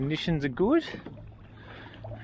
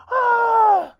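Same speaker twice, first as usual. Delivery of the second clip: slow, drawn-out, much higher and louder; stunned yell; 11 dB louder than usual. Real, not acted. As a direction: stretch each word out in shock. Still shrieking with joy.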